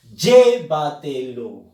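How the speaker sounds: "llévatelo" slowly, loud punch on "llé"; stress on LLÉ. Shown as a demonstration. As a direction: hit the first syllable hard, "LLÉ-va-te-lo". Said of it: "Fuerza de voz en la sílaba ye".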